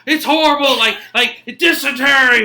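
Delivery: high, loud agonized wail; hammed-up cry of suffering; anguished soldier. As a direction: wail it out in mock agony. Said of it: "you hear him, he's like".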